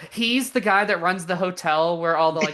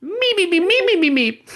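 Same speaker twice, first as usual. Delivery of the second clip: very high, louder meeping squeal; Beaker's meeping; 11 semitones higher, 5 dB louder than usual. Retold or imitated. Beaker answering Oppenheimer's monologue, very high frantic meeps. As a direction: squeal out meep after meep like a frantic lab assistant. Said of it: "it pans over to Beaker and he's like".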